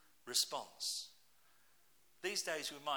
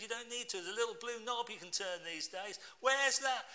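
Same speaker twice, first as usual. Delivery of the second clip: exasperated reply; 9 semitones higher, 6 dB louder than usual. Retold or imitated. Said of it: "I said".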